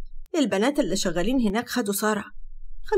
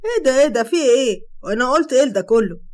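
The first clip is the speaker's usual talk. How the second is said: loud, flustered protest; exasperated; 3 semitones higher, 7 dB louder than usual. A narrator's reading. Hatem protests loudly to Sara's sudden outburst. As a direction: throw up your hands, what did I say.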